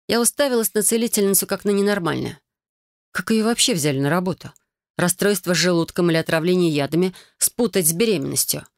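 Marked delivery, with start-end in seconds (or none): none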